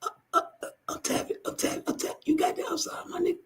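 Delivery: strangled, weak squeak; choked pleading. Acted. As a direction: gasp out a plea while being choked. Acting out someone choked, tapping out.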